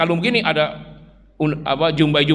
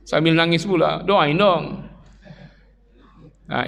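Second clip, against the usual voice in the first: ordinary talk, nothing marked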